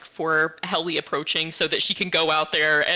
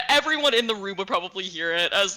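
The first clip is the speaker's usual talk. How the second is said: ordinary talk, nothing marked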